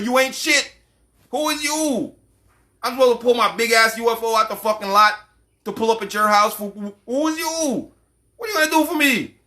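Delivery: a mock-superior spaceman sneering down at you; haughty alien.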